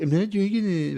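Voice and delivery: put-on high, far girlish refusal; lightly voiced little girl saying no; refusing girl voice. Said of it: "She'd be like".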